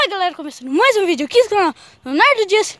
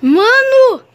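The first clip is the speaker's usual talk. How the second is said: panicked; 5 semitones higher, 5 dB louder than usual. Real, not acted. Fleeing haunted farm in fright.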